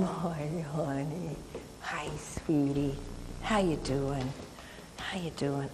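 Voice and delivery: very soft, low murmur to a dog; tender cooing. Real, not acted. Trainer greeting a dog up close, soft low murmur.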